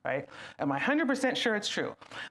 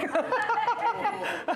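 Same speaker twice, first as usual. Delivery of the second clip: laugh; 13 semitones higher, 3 dB louder than usual. Real, not acted.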